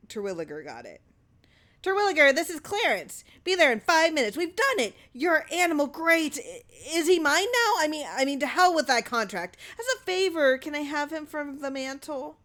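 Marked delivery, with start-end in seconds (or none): 1.8-12.4 high, loud, tumbling with excitement; excited voice. A narrator's reading. Clarence phones Terwilliger after the preview, giddy and excited. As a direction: gush it out, thrilled.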